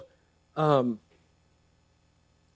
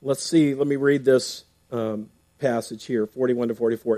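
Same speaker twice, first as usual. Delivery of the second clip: ordinary talk, nothing marked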